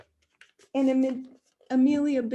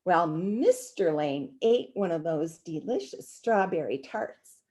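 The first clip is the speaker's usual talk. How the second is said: ordinary delivery, nothing marked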